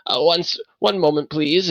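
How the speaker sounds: a hint of an embarrassed host; flustered old man. Old man asking the party to wait a moment, flustered. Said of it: "he steps and he goes".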